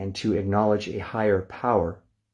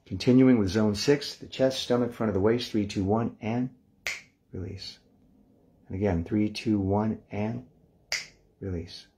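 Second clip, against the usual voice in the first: guided relaxation countdown. Acted. Teacher guiding the class through muscle release, hushed and calm.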